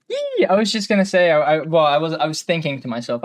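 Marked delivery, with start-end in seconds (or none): 0.1-0.4 a high, sudden yelp of delight; happy squeal at hearing her name; excited yelp. Real, not acted. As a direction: squeal with glee. Excited shout at being picked.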